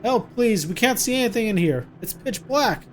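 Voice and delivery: high, loud panicked cries for help, hammed-up; fake scared shouting. Mother fakes panic so the captor resets the power, loud pretend terrified shouting. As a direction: yell for help as if terrified in the dark. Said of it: "pretend like you're really scared".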